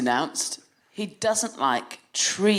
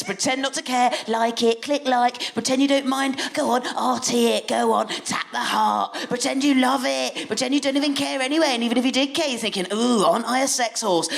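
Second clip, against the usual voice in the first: mock inner voice; 6 semitones higher than usual. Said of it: "I think".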